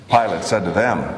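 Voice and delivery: ordinary delivery, nothing marked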